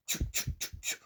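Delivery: quiet unvoiced "chu" bursts; chu sound. Shown as a demonstration. Teacher isolating the syllable, unvoiced chu. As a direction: repeat "chu" alone, barely voiced. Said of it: "It is chu".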